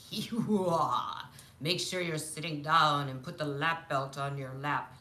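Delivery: spooky ride-host impression; haunted house voice. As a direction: put on a theatrical haunted-house announcer. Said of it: "I would hear this voice".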